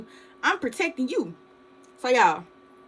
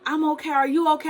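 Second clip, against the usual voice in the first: reassuring cousin; 7 semitones higher than usual. Retold or imitated. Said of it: "she was like".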